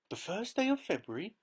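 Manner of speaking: high, loud startled question; surprised cry. A narrator's reading. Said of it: "cried Missus Bucket".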